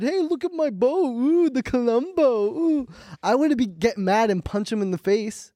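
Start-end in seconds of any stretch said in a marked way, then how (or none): 0.0-2.9 high, mocking singsong taunt; imitating a teammate's high taunt. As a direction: tease like you're begging for a punch. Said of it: "came up to me and said".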